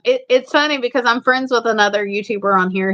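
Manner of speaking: ordinary talk, nothing marked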